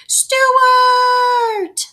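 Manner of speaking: long, high, loud call again into the hole. A narrator's reading.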